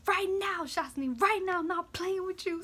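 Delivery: high, loud impression of a furious mom; imitated angry yelling. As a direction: lay down the law like a fed-up mother. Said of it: "yelling at me. She was pissed".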